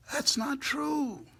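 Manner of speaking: soaring high on true; high preaching cry. Real, not acted. Preaching climax.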